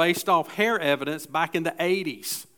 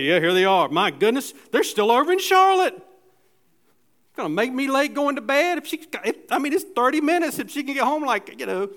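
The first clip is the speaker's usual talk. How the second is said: much higher, frantic worried parent checking the phone; fretting parent waiting up; 7 semitones higher, 3 dB louder than usual. Retold or imitated. Preacher voicing a parent waiting up late, high fretting voice. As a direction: fuss like a parent whose kid is still out late.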